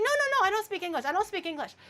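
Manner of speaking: fast, high-pitched imitation of mother; mock-helpless, rapid high protest; protesting mom. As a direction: rattle off refusals in a high mom voice. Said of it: "And she's like".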